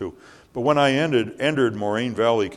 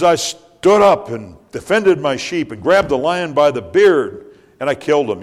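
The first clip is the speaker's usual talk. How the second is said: high, loud bold boast; proud shepherd boy telling his feat; David to Saul's men, boasting loudly; young David boasting; 7 semitones higher, 10 dB louder than usual. Acted.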